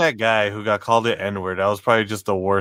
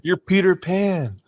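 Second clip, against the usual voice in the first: higher, drawn-out mock fairy voice; playful Tinkerbell being; 7 semitones higher, slower than usual. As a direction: imitate the dancing being, slow and high. Retold Tinkerbell being talking.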